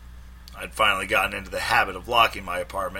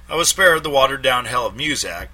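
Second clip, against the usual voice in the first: usual speaking voice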